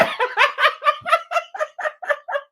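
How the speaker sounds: real delighted high laughter; high burst of laughter. Real, not acted. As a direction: crack up at the memory. Laughing at the prayer.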